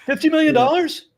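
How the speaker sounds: loud, high incredulous question; can you believe it, loud and high; astonished outburst; disbelief. Real, not acted. Disbelief at preacher's fortune.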